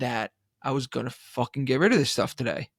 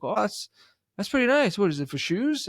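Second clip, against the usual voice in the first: higher, lightly put-on curious guy; lightly voiced stranger; 7 semitones higher than usual. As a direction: mimic the guy eyeing the table. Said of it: "He's like".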